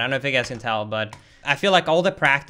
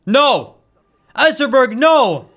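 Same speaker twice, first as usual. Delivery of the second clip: high, loud pleading no; desperate yell; 7 semitones higher, 7 dB louder, slower than usual. Real, not acted. Gamer yelling at Ezraberg, high and loud and desperate. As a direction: beg the target to die. Target fails to explode.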